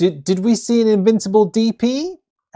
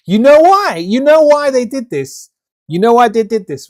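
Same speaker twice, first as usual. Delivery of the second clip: loud rant; 6 semitones higher, 9 dB louder than usual. Real, not acted. Ranting rhetorical question.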